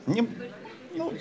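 normal talking voice

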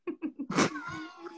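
high squealing laugh breaking up. Real, not acted. Host breaking up while reading the job script, high laughing. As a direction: cracks up mid-line. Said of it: "I'm laughing because".

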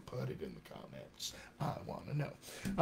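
very low, very soft aside; mock-serious hush. Coach nudging viewers for questions, mock-serious hush. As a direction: drop right down into a hushed, playful command.

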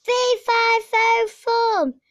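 high and loud booming roar; loud roaring giant chant. A narrator's reading. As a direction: bellow the chant like an angry giant. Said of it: "roared the giant".

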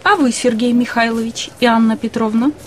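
normal talking voice

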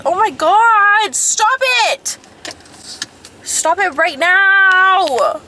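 high, loud, drawn-out shout; alarmed yelling. Real, not acted. Driver reacting to the road, high exasperated yelling. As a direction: yell it out in high-pitched exasperation.

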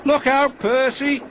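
high, loud mock warning; chuckled teasing. A narrator's reading. James mocks Percy about his dragon, chuckled teasing. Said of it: "chuckled James".

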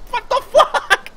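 high, slow, laughing shriek of disbelief; shocked outburst. Real, not acted. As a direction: squeal it out in stunned disbelief. Sudden shock in game.

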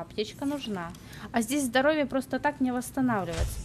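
usual speaking voice

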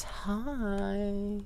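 high, coy greeting; mock sweet hello. Player teasing the monster, coy high hello.

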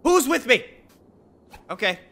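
ordinary talk

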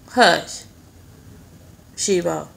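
loud, short hush to the dog; sharp scolding. Real, not acted. Owner breaks off the vlog to hush Shebo, scolding. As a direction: shush the dog with real force.

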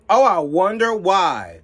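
high, loud, slow mock wonder; slow, sneering rhetorical question; sarcastic. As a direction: drip sarcasm, faking innocence. Sarcastic retort about Hamas.

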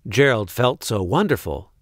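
plain reading voice

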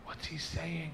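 soft, puzzled aside; hushed question. Retold or imitated. Retelling asking a stranger at the airport, hushed. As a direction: ask discreetly under the noise, softly.

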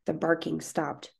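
usual speaking voice

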